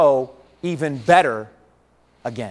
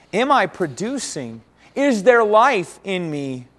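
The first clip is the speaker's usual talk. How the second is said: drawn-out, questioning each word; slow searching questions; 4 dB louder, slower than usual. Real, not acted. Preacher asking the congregation to search their hearts, slowly.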